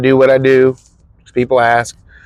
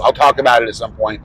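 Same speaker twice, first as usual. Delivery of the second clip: ordinary talk, nothing marked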